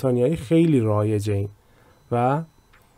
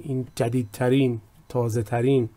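ordinary delivery

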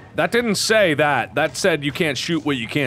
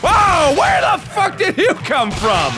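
startled outburst; 11 semitones higher, slower than usual. Real, not acted. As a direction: shout it at a sudden attacker. Startled by an enemy attack.

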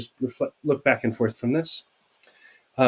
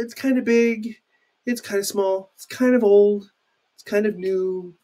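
demo kind of; 7 semitones higher than usual. Shown as a demonstration. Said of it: "it's sort of".

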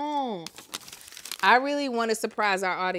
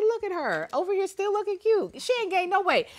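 high-pitched self-quote, lightly played; retold gushing remark; 11 semitones higher than usual. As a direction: pitch it up high in mock marvel. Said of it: "I said".

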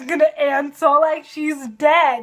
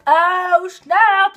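excited; 5 semitones higher, 6 dB louder than usual. Real, not acted. Reacting to plot twist.

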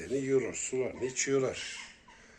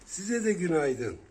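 put-on cute baby talk; 6 semitones higher, 7 dB louder, slower than usual. Greeting kittens in cute voice.

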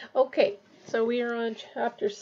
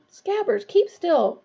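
high, louder exasperated order; urgent hiss; 7 semitones higher, 6 dB louder, slower than usual. A narrator's reading. Ron orders his rat to behave, in an urgent hiss. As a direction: scold the squirming pet urgently. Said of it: "Ron hissed".

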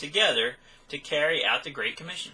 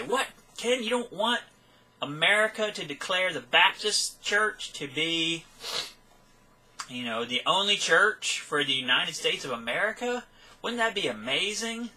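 lightly voiced eager objector, pitched up in places; mock objector; 5 semitones higher than usual. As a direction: put the naive question in someone else's mouth. Voicing an objector's enthusiastic question.

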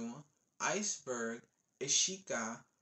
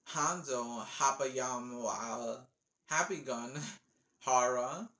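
normal talking voice

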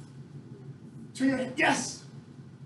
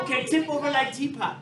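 normal talking voice

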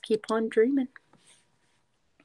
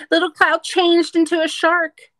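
excited; 8 semitones higher, 8 dB louder than usual. Acted. Excited page reveal.

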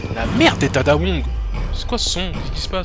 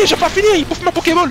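ordinary talk

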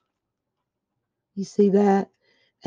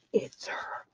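gasped, much softer whisper; breathless whisper; 13 dB quieter than usual. Real, not acted. Crafter spotting the figure on the card, awed whisper. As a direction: breathe it out in awe. Gasp at gift reveal.

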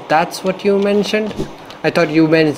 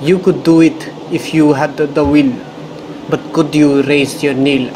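put-on Russian accent. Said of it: "I don't know why I'm giving her a Russian accent".

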